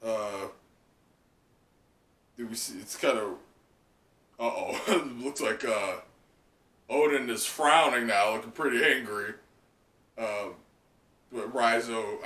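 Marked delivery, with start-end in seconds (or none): none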